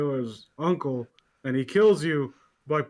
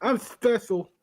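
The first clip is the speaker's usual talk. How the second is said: smug; 6 semitones higher, slower than usual. Pretend. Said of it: "don't I get praise?".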